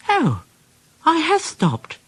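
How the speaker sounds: high hushed wonder; relieved Percy. A narrator's reading. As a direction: sigh with relief.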